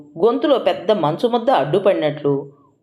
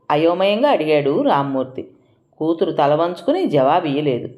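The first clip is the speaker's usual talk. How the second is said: ordinary delivery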